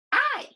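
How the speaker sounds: "I" said high and loud as a long vowel. Shown as a demonstration. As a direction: sing out the long I sound. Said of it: "What's the long I sound?".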